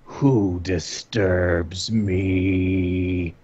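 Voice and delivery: slow, deep rumbling monster. Acted. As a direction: roar it out like an ancient beast.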